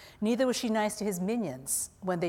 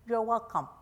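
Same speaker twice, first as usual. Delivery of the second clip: higher, careful echo of "You're welcome"; learner repeating; 4 semitones higher than usual. A narrator's reading. Mum copies her English teacher, learner repeating "You're welcome". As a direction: voice a language student trying out "You're welcome". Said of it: "You should say you're welcome".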